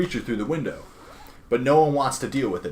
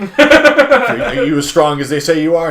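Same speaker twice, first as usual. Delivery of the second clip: mock tough guy; 6 semitones higher, 12 dB louder, slower than usual. Voicing Eagle Man's question to Clark.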